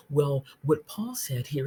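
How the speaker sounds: ordinary talk, nothing marked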